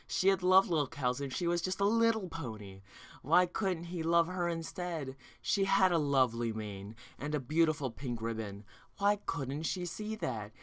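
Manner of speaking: ordinary delivery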